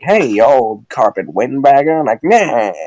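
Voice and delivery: lightly voiced old-timey heckler; mock great-grandfather jeering at the jukebox club; old-timer's insult. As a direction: toss out an old-fashioned insult, hammed up. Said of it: "great-grandfather used, like, down at the, like, jukebox club".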